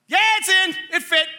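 high, defensive holler as dad; mock calling out. Dad assures mom that it fit, mock holler. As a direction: call it back high to cover up.

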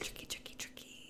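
soft, voiceless mock-villain whisper; hushed whisper. Co-host voicing the villain finding empty clothes, sly whisper. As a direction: whisper it as if sneaking up.

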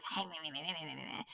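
low, soft mock creature babble; quiet mocking meh meh chatter; mocking blah blah. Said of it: "pop up and be like".

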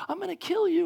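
lightly voiced high-pitched threatening lady; mock threat. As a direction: make a high, playful death threat. Acting threatening lady in story.

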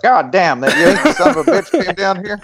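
put-on high, indignant Texan old-booster voice; hammed-up cussing indignation; Joe Jamel. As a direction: imitate an outraged Southerner, amused underneath. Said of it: "Joe Jamel will be like".

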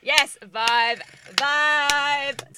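loud, high, gushing yes, yes, vibe; excited shouting. Real, not acted. Friend cheers loudly about loving the show, excited. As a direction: blurt it out in pure fan excitement.

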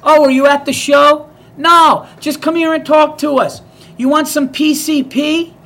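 loud high sing-song come-on; hammed-up street call; mock yell. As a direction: holler the offer across the street. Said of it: "they yelled it".

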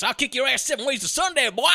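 tough Southern thug. Retold or imitated. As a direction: threaten like a jailhouse bully. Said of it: "Aaron couldn't kick his own ass".

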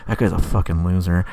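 lightly acted muttered insult; under their breath. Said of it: "you can just hear under their breath".

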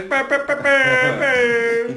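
high honking klaxon bows; klaxon imitation. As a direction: honk it like a klaxon. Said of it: "that klaxon that goes".

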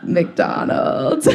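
deadpan word with a laugh in it; laughing punchline. Retold or imitated. Punchline about airport dinner.

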